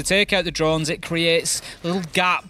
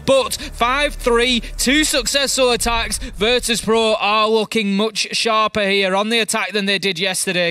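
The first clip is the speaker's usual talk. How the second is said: raised, urgent commentary pitch; excited play-by-play; 6 semitones higher than usual. Real, not acted. Caster calling a round-winning kill to viewers, excited play-by-play. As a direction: call the final kill with rising excitement.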